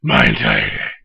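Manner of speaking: deep gravelly Batman growl; low, growling caped crusader. Acted. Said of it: "I thought you were gonna say Batman".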